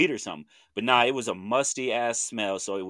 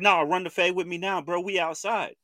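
raised-pitch mock challenge; retold yelling challenge; 8 semitones higher than usual. Stranger challenging him by the car, mildly yelled in retelling. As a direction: imitate someone calling you out to fight. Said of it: "he keeps yelling".

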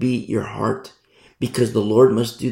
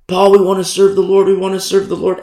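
higher and louder, keen; eager believers imitated, pitched up and louder; eager declaring; 6 semitones higher, 6 dB louder, faster than usual. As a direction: announce it eagerly to Paul. Christians telling Pastor Paul.

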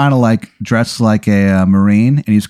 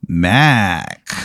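greeting back; 5 semitones lower than usual. Acted. Employee greets the player back.

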